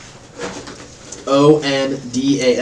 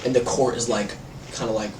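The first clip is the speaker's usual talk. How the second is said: usual speaking voice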